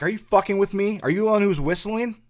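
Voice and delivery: high, loud acted accusation; indignant shouted questioning; pissed-off confrontation. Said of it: "So she gets pissed".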